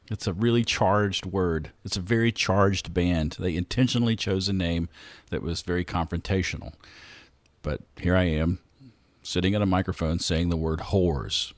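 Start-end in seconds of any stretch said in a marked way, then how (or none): none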